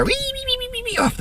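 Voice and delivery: very high, tiny squeal like a little pig running home; squeaky wee wee wee. Retold or imitated. Mocking religious guys who run off, very high squeal.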